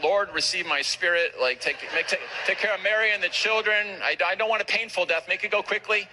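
mock panicked prayer. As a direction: plead with God as if about to die, played for laughs. Panicked silent prayer fearing death.

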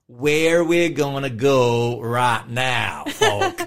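slow, drawn-out mock-American twang; dodgy American accent. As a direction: ham up a bad American drawl. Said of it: "We don't even call that an American accent".

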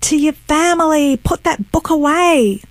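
imitated exasperated scolding; retold scolding. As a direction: mimic an indignant relative telling someone off. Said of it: "gets up and he goes".

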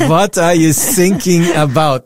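slow mock-German question; terrible German accent, drawn out; bad German accent. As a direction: do a hammy German coast guard. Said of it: "That is the worst German accent".

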